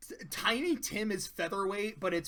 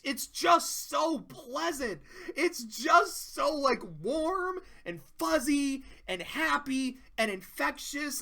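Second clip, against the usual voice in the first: higher, slow, choked with emotion; tearing up; 8 semitones higher, slower than usual. Real, not acted. Gushing about Pinkie's song while welling up with tears. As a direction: let the tears catch in the voice. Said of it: "I'm tearing up a little bit".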